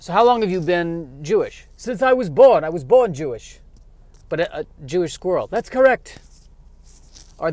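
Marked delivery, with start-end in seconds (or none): 1.8-3.6 put-on high, loud Yiddish-accented squirrel. Squirrel character interview. 5.5-6.2 put-on high, loud Yiddish-accented squirrel. Squirrel character answers.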